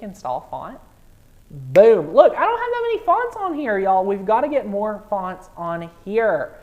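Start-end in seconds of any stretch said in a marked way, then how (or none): 1.5-6.6 loud, high exclamation easing down by the end; excited outburst. Real, not acted. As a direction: burst out in delighted surprise. New font appears during live stream.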